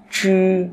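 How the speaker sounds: "chư" with tongue curled back, unaspirated; curled-tongue sound. Shown as a demonstration. Teacher drills students, "chư" retroflex and unaspirated. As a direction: hold "chư" high and level, no air puff. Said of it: "Các bạn quặt lưỡi lên".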